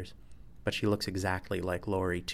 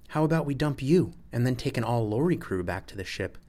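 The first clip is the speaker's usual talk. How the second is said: higher, needling counter-offer; pointed, combative retort; defiant challenge; 5 semitones higher, 4 dB louder than usual. A narrator's reading. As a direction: throw it back as a dare. Lori two threatens Sol, defiant.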